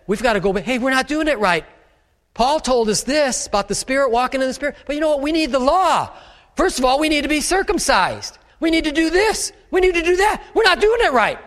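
high, insistent voice of the false teachers; Judaizer teachers. Retold or imitated. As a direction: press the rules on them, rising. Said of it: "that's the way they said".

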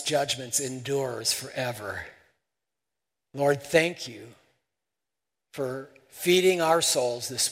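hushed pastoral prayer. Real, not acted. Prays closing prayer.

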